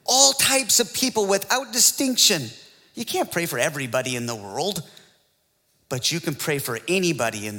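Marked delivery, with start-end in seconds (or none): 0.0-2.5 "All types of people without distinction" driven home, higher; raised, insistent stress from "All" through "distinction"; emphatic restatement. Real, not acted. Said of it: "is the emphasis here".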